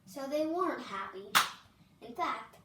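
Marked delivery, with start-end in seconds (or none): none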